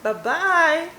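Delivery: high, bright-sounding sing-song bye-bye; cheeky sing-song farewell. Acted. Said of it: "Today, I'm going to say".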